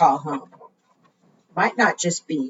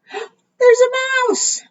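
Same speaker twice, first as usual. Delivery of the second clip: much higher, slow gasping discovery; gasp of delight; much slower than usual. Acted. Librarian reveals the hidden mouse to children, gasping in surprise. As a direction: gasp as if you truly found it. Mouse found under the flap.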